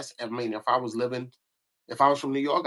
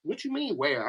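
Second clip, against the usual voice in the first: 1 offended Brooklyn dudes; 10 semitones higher than usual. Retold or imitated. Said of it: "all the Brooklyn dudes was like".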